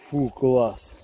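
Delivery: usual speaking voice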